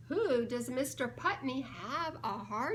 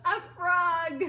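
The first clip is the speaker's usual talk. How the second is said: louder answer breaking into laughter; laughing through the answer; 5 semitones higher, 8 dB louder than usual. Real, not acted. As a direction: blurt it out mid-giggle. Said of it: "I laugh every time I see that one".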